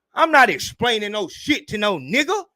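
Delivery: ordinary talk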